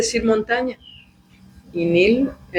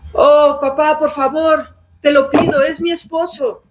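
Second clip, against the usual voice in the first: high, plaintive plea; begging daughter; 6 semitones higher than usual. Retold or imitated. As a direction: plead with papa, whining. Daughter begs father again. (hija rogando al padre, voz aguda y quejosa)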